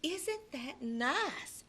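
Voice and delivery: slow, soft, sing-song Isn't that nice; sweet motherly. Acted. Mother hands her son a party invitation, slow and sweet. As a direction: coax him gently like a mom.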